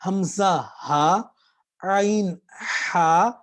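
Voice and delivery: ہمزہ ہا slow, then عین ہا pressed; slow throat drill. Shown as a demonstration. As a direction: drill the letters slowly. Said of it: "جہاں سے عین نکلے گی".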